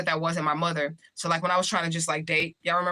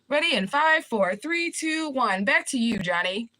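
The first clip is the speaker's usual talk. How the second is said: higher hammed-up countdown then hand it back to the studio; playing a news anchor throwing back to Johnny, higher playful read; mock anchor sign-off; 7 semitones higher, slower than usual.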